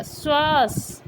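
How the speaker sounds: श्वास said slowly, with a clear palatal श; correct pronunciation. Shown as a demonstration. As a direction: stretch श्वास out, crisp श sound. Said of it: "ये हमारा सही शब्द होता है". (श्वास धीरे और साफ़ तालव्य श के साथ)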